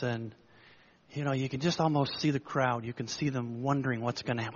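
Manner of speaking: ordinary talk, nothing marked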